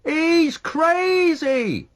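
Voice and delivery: high, loud, very slow scream. A narrator's reading. Someone on the quay screaming at the ship. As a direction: scream it out in disbelief. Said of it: "screamed someone".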